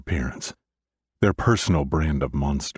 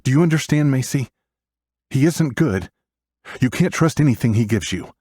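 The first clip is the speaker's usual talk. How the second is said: raised, urgent warning voice; stern big brother; 9 semitones higher, 3 dB louder than usual. A narrator's reading. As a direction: press the point hard, higher pitched. Brother urgently warns his sister.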